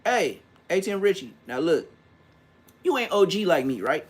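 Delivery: ordinary talk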